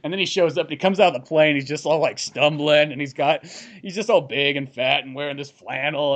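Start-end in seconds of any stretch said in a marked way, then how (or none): none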